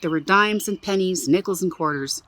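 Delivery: usual speaking voice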